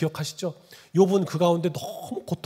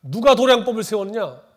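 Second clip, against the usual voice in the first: loud, forceful divine challenge; God confronting Job; 5 semitones higher, 8 dB louder than usual. Acted. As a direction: thunder the question at Job as God. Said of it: "이렇게 말하는 거예요". (욥을 꾸짖는 하나님의 우렁찬 목소리)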